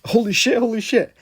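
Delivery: high, loud panicked yelp on seeing fire. Retold or imitated. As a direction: blurt it out in sudden alarm. Realizing his leg is on fire.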